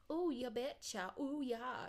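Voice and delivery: hammed-up Minnesota lilt; dramatic Minnesota accent. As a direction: imitate an over-the-top online Minnesotan. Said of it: "they're so fucking dramatic online".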